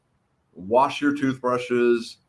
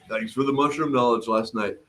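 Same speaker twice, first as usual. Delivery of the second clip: usual speaking voice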